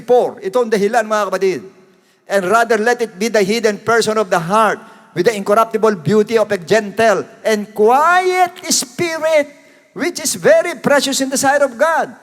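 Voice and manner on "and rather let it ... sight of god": solemn preacher's Bible reading; scripture reading. Acted. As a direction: proclaim the verse to the congregation.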